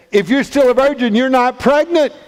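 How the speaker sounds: high, insistent argument; incredulous retort. Acted. Joseph argues back in disbelief.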